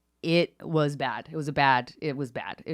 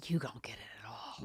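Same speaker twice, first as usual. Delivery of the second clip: hushed, breath-only frustration; whispered exasperation; 5 semitones lower, 14 dB quieter than usual. Retold or imitated. Daughter despairing at parents outside her door, whispered exasperation. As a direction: whisper it, drained of volume. Retold plea, voice drops to whisper.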